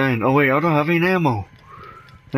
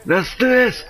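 high, pumped-up shout; rally yourself, full hype; hyped battle cry; 11 semitones higher than usual. Acted.

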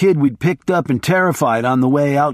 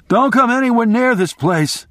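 higher, shouted warning; higher shouted warning down the phone; 9 semitones higher than usual. Retold or imitated. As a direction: bellow it into the phone. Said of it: "I shouted".

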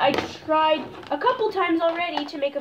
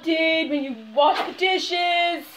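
yelling at brother; 4 semitones higher, 7 dB louder than usual. Real, not acted. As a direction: shout the chore across the house. Yelling at brother about dishes.

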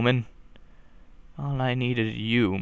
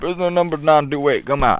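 fast, louder guard call; mock prison guard; 4 semitones higher, 4 dB louder, faster than usual. Guard calling a prisoner out of his cell, gruff. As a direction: bark it like a bored jailer.

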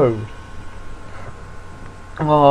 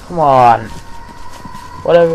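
ordinary delivery

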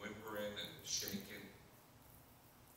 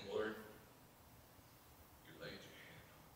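whispered, quick prayer; soft whispered thanks; hushed prayer; 11 dB quieter, faster than usual. Acted. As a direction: breathe it to God. Quoting psalm in prayer.

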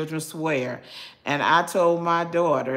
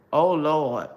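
usual speaking voice

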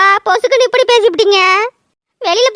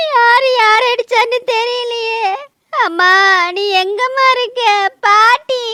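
breaking into tears; sobbing and frightened; crying. Acted. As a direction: weep out the worry. Sees blood, starts crying.

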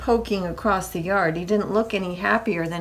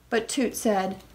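ordinary delivery

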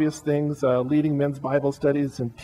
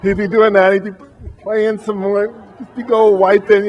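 loud, higher mock worship leader; a hammed-up, louder worship leader; imitating James leading worship; 5 semitones higher, 10 dB louder than usual. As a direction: act out James sweating up front.

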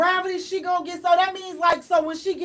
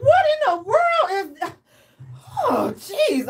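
much higher incredulous cry, then a sighing oh jeez; disgusted; 7 semitones higher, slower than usual. Retold or imitated. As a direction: recoil in horrified disbelief. Retelling disgust at the birth.

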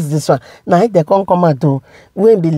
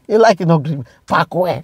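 ordinary talk

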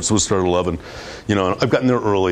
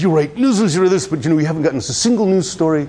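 much higher mock-furious outburst, repeating write; angry client imitation; 7 semitones higher than usual. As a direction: imitate the client losing his temper, pitched up high. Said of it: "like, really angry".

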